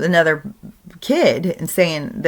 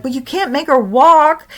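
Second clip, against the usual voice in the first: put-on much higher child voice; protesting little girl; 9 semitones higher, 5 dB louder than usual. Granddaughter protesting to grandpa, high child voice.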